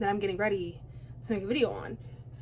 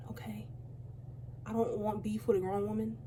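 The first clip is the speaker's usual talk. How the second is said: whisper; 6 dB quieter than usual. Real, not acted. Sixteen, no beef aside.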